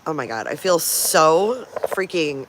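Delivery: usual speaking voice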